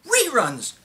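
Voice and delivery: high, loud cry of disbelief; outraged outburst. Acted. Ranting about callers upset at cancelled TV, outraged. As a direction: explode on the one word, incredulous.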